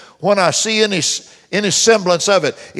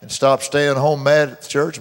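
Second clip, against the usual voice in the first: ordinary talk, nothing marked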